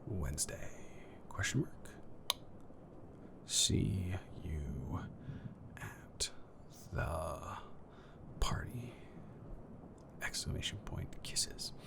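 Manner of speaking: unvoiced whisper reading out punctuation. Whispering a text message to a friend. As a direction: hush it like typing a secret.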